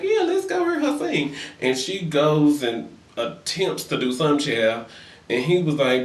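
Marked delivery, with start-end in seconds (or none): 0.0-1.3 high, eager mock voice; mock eager boyfriend. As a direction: squeak it out like an overeager yes-man.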